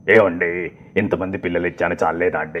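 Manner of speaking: low altered film voice; Kamal Haasan impression. Husband asking if so many children aren't enough, low character voice. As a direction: drop the pitch and plead in the character's voice. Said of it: "అలా మార్చి పాడారు".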